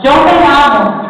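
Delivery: firm, loud self-affirmation; loud declaration. Shown as a demonstration. Pastor leads the congregation in saying it, loudly. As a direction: proclaim it to yourself. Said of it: "Y decirle".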